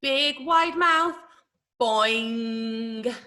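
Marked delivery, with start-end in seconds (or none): 1.8-3.1 long, ringing boing; sustained, level boing; bouncing ball sound. Shown as a demonstration. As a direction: draw it out like a springy ball. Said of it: "might make a boing sound".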